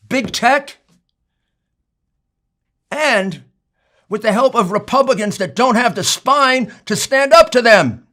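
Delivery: real rising anger at a cheating opponent; heated outrage. Real, not acted. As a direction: hammer home the outrage at being cheated. Angry rant about stolen election.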